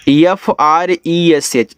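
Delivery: slow, letter by letter; spelling out. Shown as a demonstration. Teacher spelling a word for beginners, slow spelling out. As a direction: spell it out slowly for learners.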